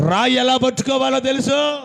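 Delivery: loud, high declaration. Acted. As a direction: proclaim it boldly. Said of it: "చెప్పేసి గట్టిగా".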